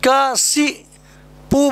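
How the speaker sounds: कशिपु drawn out slowly, ka-shi-pu; slow model word कशिपु for the class; slow careful demo. Shown as a demonstration. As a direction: stretch कशिपु so students catch each syllable. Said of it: "और कशिपु बोलना".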